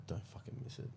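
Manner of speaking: under the breath, barely voiced; whispered plea. Spotter silently willing the lifter on, whispered. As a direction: whisper it like an anxious private thought.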